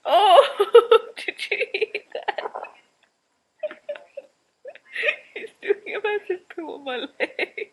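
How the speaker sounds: laughing through the words; can't stop giggling. Real, not acted. Mum laughing as the baby poos on her leg.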